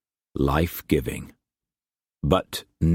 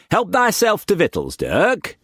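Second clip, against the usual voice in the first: put-on much higher, hammy old sailor voice; old sea-dog Brock; 13 semitones higher than usual. Brock welcoming Struan to his table, hammed-up old sailor. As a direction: boom it out like a rough old trader.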